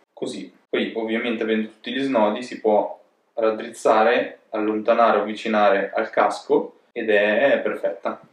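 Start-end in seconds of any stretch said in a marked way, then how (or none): none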